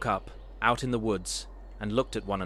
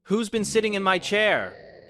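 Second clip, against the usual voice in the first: high, loud roar; 11 semitones higher, 6 dB louder than usual. A narrator's reading. Papa Bear finding his chair sat in, loud roar. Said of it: "he roared".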